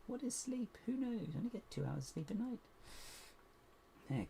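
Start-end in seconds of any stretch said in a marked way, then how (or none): 0.1-2.6 high, soft mock squeaky voice, half joking; a silly high little voice mocking her own tiredness; mock squeaky aside. Builder at a school night stream, joking about sleep, squeaky high voice.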